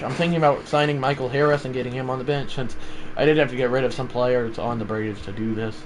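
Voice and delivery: usual speaking voice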